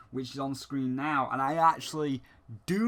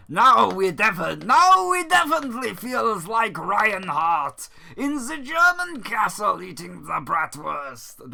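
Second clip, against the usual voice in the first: hammed-up German accent, much higher and louder; terrible German accent; 8 semitones higher, 8 dB louder than usual. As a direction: boom it out in an over-the-top fake German accent. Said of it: "That was a terrible German impression".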